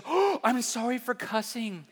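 high, drawn-out mock apology; gasp, then apologize in a hammed-up high voice; mortified hairdresser. Hairdresser learning her client is a pastor, mock-mortified apology.

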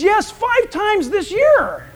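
a lightly voiced eager boy; proud teen. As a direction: answer with innocent pride. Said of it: "He says".

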